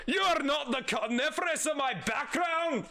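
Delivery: high, shouted character voice; put-on villain voice. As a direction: call it out in a big hammed-up villain voice. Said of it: "That's the one we're sticking with".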